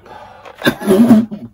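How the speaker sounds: sharp real cough from spice; burning-mouth cough; loud cough. Real, not acted. Coughing from spicy bean.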